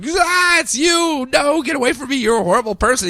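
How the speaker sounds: high frightened yelp, pulling away; panicked shriek. Retold or imitated. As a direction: shriek in fear, backing off. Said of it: "She's like".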